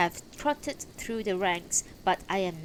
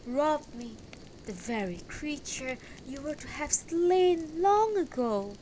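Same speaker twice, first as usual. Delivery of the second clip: parched voice; 6 semitones higher than usual. Acted. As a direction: tell the sorrowful tale in a thin, high voice. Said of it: "a parched voice behind said".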